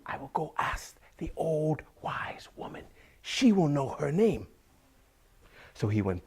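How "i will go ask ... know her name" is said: high farmer voice, excited; raised-pitch eager announcement; Saeed's bright idea. Acted. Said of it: "Said said".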